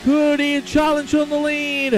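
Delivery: high, excited race announcer; live race commentary. Acted. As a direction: call a last-gasp finish, holding the key words long.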